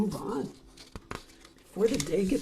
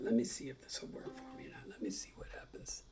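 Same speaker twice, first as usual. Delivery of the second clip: whisper; 8 semitones lower, 13 dB quieter, faster than usual. Real, not acted. Fiddling with the camera.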